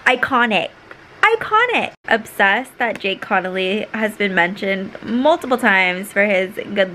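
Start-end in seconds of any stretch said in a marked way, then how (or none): 1.2-1.9 excited squeal. Real, not acted. Repeats word, second squealed.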